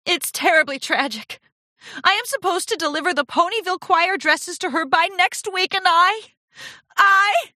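high-pitched melodramatic lament; theatrical near-tears wail; quivering distress. A narrator's reading. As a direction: build from tragic lament to loud stammer. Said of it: "Rarity's lip quivered".

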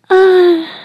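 high, loud cry of pleasure. Acted. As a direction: let out a sharp ecstatic cry. Climax cry.

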